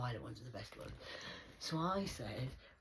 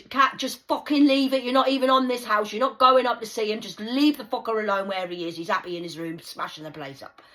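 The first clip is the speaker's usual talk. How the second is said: loud retold; 7 semitones higher, 17 dB louder than usual. Coworker voiced scolding her.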